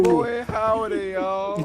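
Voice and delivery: high, slow, drawn-out cowboy greeting; howdy like a rodeo cowboy; cowboy accent. Acted. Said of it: "Strong cowboy start to this".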